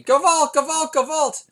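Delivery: high, loud comic wail of "Gewalt"; mock outcry. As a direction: cry out "Gewalt" like a dramatic alarm. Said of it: "I'll say".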